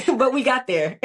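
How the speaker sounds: real laughter around the words; laughing. Real, not acted. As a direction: laugh it off, voice lifting. Laughing at her own disaster.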